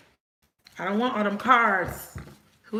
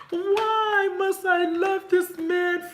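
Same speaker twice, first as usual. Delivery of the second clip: much higher, louder, slow hammed-up wail; mock lovesick lament; 10 semitones higher, 3 dB louder, much slower than usual. Voicing Chelsea wailing over the man she loves, hammed up. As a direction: overplay a soap-opera heartbreak.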